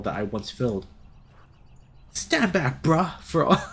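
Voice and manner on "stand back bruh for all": heroic role. Acted. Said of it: "Liam swiftly snapped into character".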